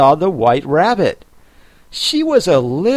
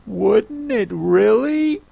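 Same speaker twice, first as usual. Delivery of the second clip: surprised; 8 semitones higher, slower than usual. A narrator's reading. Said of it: "in a tone of great surprise".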